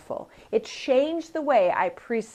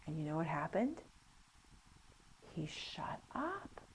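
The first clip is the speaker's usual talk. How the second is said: hushed dramatic whisper; 4 semitones lower, 17 dB quieter than usual. Real, not acted. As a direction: drop to a whisper for the punchline. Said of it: "hushed punchline".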